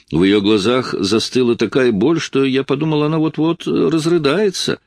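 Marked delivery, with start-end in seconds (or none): none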